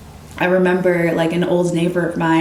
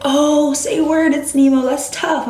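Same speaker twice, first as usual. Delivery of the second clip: excited; 8 semitones higher, slower than usual. Retold or imitated. Partygoer reacting to her nickname.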